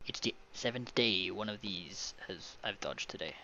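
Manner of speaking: understated grumble, on the low side; mildly grumbling narrated character. A narrator's reading. A man complains of dodging one all day, lightly grumbled. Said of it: "the man grumbled".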